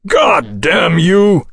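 furious roar. Acted. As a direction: roar it in fury. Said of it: "Houston roared".

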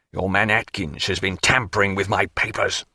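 low angry accusation. A narrator's reading. Canning accusing Maturin's man, darkly angry. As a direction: repeat the charge in a low voice. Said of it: "and in his passion".

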